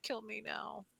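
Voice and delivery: faint, drained mock plea; barely there, begging in the old cat's voice; old cat voiced as worn out and very soft; weary old cat. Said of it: "he's like".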